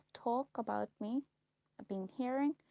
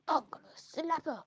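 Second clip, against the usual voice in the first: mock gossip; 5 semitones higher than usual. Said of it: "that woman sitting there going".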